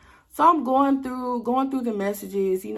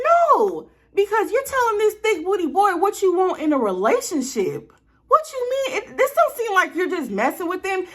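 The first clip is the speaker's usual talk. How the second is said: high, loud indignant objection; not buying it, push back hard; heated disbelief; 11 semitones higher, 4 dB louder than usual. Retold or imitated. Confronting his excuse.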